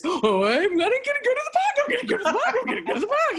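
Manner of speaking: very high, squeaky dog voice that is almost laughing; excited husky voice. Retold or imitated. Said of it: "the husky goes".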